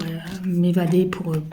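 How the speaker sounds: normal talking voice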